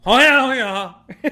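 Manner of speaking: high, loud mumbled mock Cajun; mumbling Cajun worker. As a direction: garble it like an unintelligible Cajun hand. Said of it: "the other hand comes out and you go".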